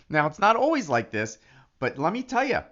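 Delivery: ordinary delivery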